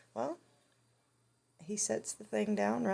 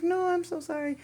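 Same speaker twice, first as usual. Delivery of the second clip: imitated woman apologizing, high and loud; high, loud retold protest; flustered owner; 11 semitones higher, 6 dB louder than usual. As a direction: mimic a flustered apology in a higher voice.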